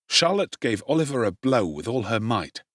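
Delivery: plain reading voice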